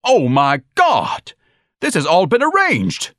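high, loud, slow-drawn outcry; screaming. A narrator's reading. Son discovering a plot against him, screaming. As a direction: scream it out in outrage, stretching the words. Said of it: "Ignatius screamed".

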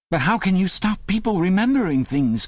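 high-pitched, desperate protest; urgent, high outburst; anguished cry. A narrator's reading. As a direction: cry out in protest, voice leaping far up. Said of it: "cried Winston".

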